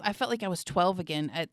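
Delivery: ordinary talk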